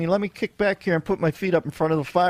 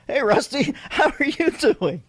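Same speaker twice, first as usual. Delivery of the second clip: high, hammed-up man-to-puppet greeting; 8 semitones higher than usual. Shown as a demonstration. Host greeting a puppet, hammed-up man voice. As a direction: put on a mock man voice, pitched up. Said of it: "give you my man voice".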